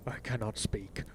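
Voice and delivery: hushed, broken with shame; almost no voice left; ashamed, barely audible. Acted. Ashamed Cassio breaks down.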